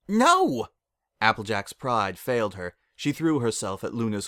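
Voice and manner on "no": high, loud frantic cry. A narrator's reading. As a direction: cry it out in alarm. Applejack begs, frantic.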